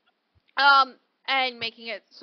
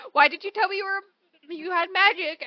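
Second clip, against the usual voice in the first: upset quote; 6 semitones higher, 4 dB louder than usual. Retold or imitated. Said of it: "where he's all like".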